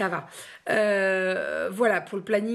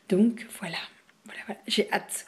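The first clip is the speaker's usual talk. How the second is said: whispering. Real, not acted. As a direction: breathe the words out, barely voiced. Trailing off to herself.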